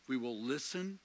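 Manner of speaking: usual speaking voice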